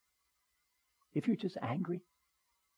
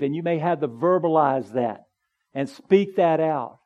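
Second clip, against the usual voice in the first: rising, louder and louder, a pleading prayer; preaching at full force; 6 semitones higher, 10 dB louder than usual. Acted. Preacher voicing a troubled believer's prayer to God, building to a full-force plea. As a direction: pour it out to God, building to a loud climax.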